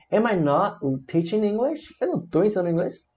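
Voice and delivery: "Am I not teaching English" high, rising question; negative question intonation. Shown as a demonstration. Teacher modelling a negative question, "Am I not teaching English" high and rising. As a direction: query it upward, "Eu não tô ensinando inglês". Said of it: "frases negativas e interrogativas".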